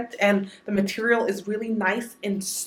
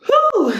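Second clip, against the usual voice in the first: high, loud exhausted whew; breathless whew; 8 dB louder than usual. Real, not acted. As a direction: blow out a tired, loud whew. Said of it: "I'm so out of breath".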